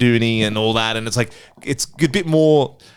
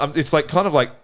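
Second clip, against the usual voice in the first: usual speaking voice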